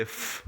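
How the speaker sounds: F as a long hissed "fff"; letter's sound. Shown as a demonstration. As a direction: give F as pure "fff", no vowel. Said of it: "you normally get a F sound".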